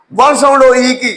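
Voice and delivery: ordinary talk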